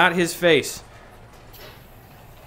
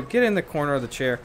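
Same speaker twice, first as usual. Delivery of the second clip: ordinary delivery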